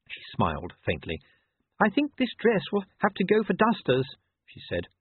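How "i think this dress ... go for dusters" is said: much higher-pitched lady's voice; voiced lady. Sybil to her husband after the coach, high lady's voice.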